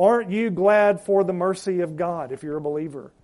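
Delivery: ordinary talk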